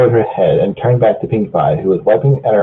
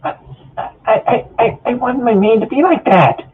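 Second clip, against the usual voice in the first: much higher, stammering sob voice; tearful Pinkie; 14 semitones higher than usual. Acted. Pinkie confessing to Rarity, high and tearful. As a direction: blurt it through tears.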